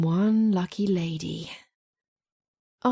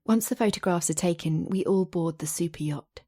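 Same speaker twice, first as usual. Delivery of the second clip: plain reading voice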